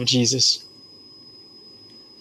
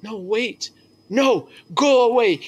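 high, raised cry; retold yell; 8 semitones higher than usual. Prisoner yells at the priest to go away, high. Prisoner rejects the governor again.

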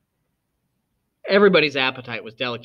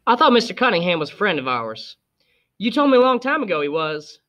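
put-on higher childish voice, puzzled; a girl confused about a friend; young Scout; 6 semitones higher than usual. A narrator's reading. Scout questioning her father, higher and puzzled.

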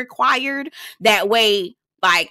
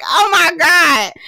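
high, loud, drawn-out exclamation; hollered reaction; 8 semitones higher, 6 dB louder, much slower than usual. Retold or imitated. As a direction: relive the shriek of disbelief, stretched out. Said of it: "hollered. I was like".

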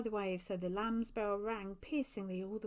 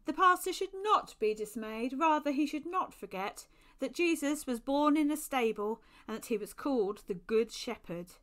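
commanding angel voice; 5 semitones higher, 8 dB louder than usual. Acted. Angel rebukes priest, wings spread.

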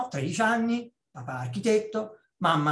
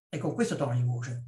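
ordinary talk

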